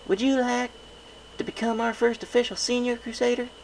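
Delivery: high little-sister voice, coaxing; a small filly asking big sis a favour; young filly. A narrator's reading. Filly asks hopefully.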